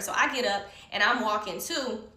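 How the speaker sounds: ordinary delivery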